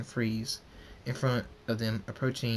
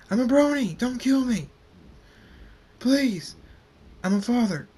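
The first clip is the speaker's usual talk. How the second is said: very high, loud pleading cries; desperate yelling; 13 semitones higher, 8 dB louder than usual. Acted. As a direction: beg for your life at full voice. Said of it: "He yells".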